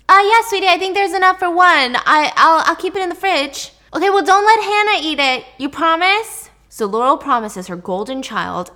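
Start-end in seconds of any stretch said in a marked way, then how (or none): 0.1-3.7 much higher, louder sweet mom; doting mom voice. Retold or imitated. Mom answers her favorite daughter, high sweet voice. 4.0-6.5 put-on bright, higher teen voice, louder; cheerful teen daughter. Daughter calls to mom on her way out, cheerful teen voice.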